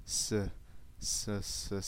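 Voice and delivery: ordinary talk, nothing marked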